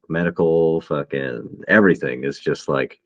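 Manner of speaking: ordinary talk, nothing marked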